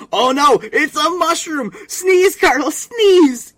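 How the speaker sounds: high, loud, slow screech; mock Sheen screaming. Imitating Sheen urging Carl to sneeze, high shrieking. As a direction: scream it like a cartoon kid begging. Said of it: "is like".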